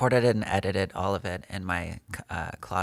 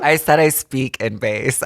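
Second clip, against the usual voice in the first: emphatic correction; 4 semitones higher, 10 dB louder, slower than usual. Real, not acted. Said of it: "I just have a deep voice".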